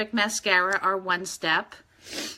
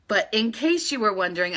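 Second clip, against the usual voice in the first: ordinary talk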